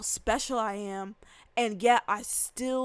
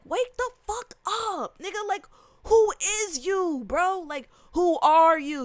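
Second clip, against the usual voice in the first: much higher and louder, slowed on "wake the fuck up"; exasperated call-out; 7 semitones higher, 6 dB louder than usual. Retold or imitated. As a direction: shake them awake with your voice. Calling out coworkers.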